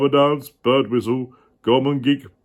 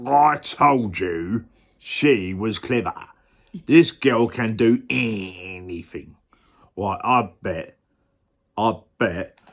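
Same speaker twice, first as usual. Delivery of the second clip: Ray Winstone voice; 3 semitones lower than usual. Acted. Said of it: "More Ray Winstone".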